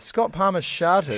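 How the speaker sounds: slow, loud, disbelieving; incredulous. Real, not acted. Cohost hearing a gross story, slow loud disbelief. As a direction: stretch out the shock.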